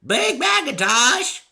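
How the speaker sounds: high, slow creaky granny call; hollering old mare; creaky old woman. A narrator's reading. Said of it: "called the voice".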